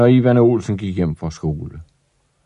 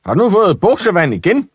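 high, fast, loud father's shout; angry shouting father; 10 semitones higher, 3 dB louder, faster than usual. Acted. Father shouting at Ivan over wet trousers, high fast shout. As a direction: bark it out in fury. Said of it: "begyndte lige med det samme at råbe meget højt".

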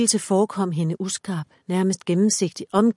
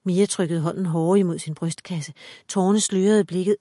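plain reading voice

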